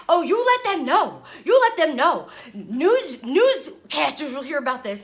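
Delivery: high, loud, frantic outburst; freaking out. Retold or imitated. Telling her husband the airline will hear about this, a high frantic yell. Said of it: "I'm freaking out".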